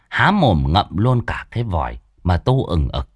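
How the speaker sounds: usual speaking voice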